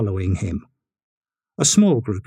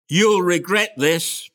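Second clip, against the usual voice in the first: higher, slowed mild shout from the train; shouted threat; 6 semitones higher, slower than usual. A narrator's reading. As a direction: call it back at the crowd. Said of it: "he shouted".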